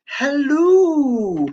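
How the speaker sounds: a light British "Hello" with the end said as "lu". Retold or imitated. Reading a viewer's typed greeting, mild British accent. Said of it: "makes it sound like she's British".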